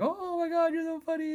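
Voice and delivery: very high falsetto mimicry; imitated amused ladies. Ladies who can't believe the laid-off dad, imitated in falsetto. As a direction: squeal it in a put-on falsetto.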